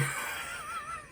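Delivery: high, quiet laugh. Real, not acted. Can't remember Fred's name, high soft laugh. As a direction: laugh softly at your own slip.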